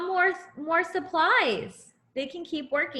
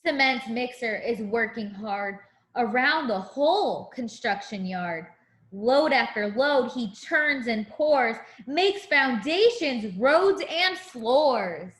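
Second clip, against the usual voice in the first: sing-song picture book rhyme; reading to a child; 4 dB louder than usual. Acted. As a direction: read it like a bedtime rhyme.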